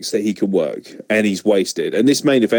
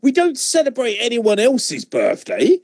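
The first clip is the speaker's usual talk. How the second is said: normal talking voice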